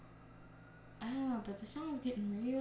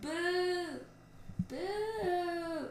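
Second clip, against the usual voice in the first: high, drawn-out boo; heckler's long, high-pitched boo; jeering boo; 9 semitones higher, 4 dB louder than usual. Real, not acted. Jeering at someone on TV.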